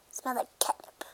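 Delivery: hushed whisper about catnip. Pretend. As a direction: breathe it as a secret. Line repeated as whisper.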